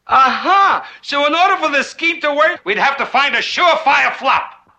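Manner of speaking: high, eager realization; excited scheming. Acted. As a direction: seize on the scheme with rising glee.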